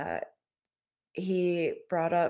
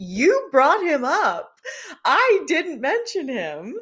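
slow, loud, high protest; hammed-up exasperated comeback; retold exasperation; 9 semitones higher, 7 dB louder, slower than usual. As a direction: stretch each word in mock indignant disbelief. Retold exasperated reply to the date.

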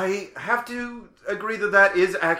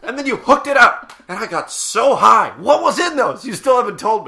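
loud, raised-pitch accusation; indignant demand; 4 semitones higher, 7 dB louder than usual. Acted. Questioning friend about mystery IV bags, indignant demand. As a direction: demand an answer, loud and outraged.

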